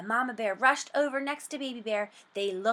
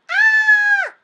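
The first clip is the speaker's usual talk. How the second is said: piercing high, very loud scream; shriek; 16 dB louder than usual. A narrator's reading. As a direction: scream in terror. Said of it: "Goldilocks shrieked".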